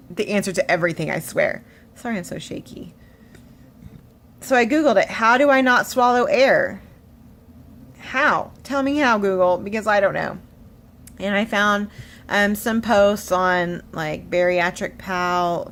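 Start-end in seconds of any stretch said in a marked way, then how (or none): 5.1-10.4 loud, high, insistent pleading; frustrated demand. Retold or imitated. Patient asking Google for help, acted loud frustration. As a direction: demand an answer from the search engine.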